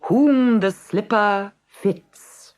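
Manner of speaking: high, slow herald voice; royal proclamation. A narrator's reading. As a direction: proclaim it to the town. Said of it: "the queen issued a proclamation".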